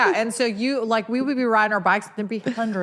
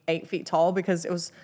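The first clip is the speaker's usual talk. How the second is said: ordinary talk, nothing marked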